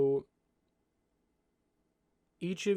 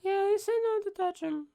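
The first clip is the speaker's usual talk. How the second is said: put-on very high little kid voice; small child; 7 dB louder than usual. Young halfling answering the adults' questions, in a high child voice. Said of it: "this child cannot".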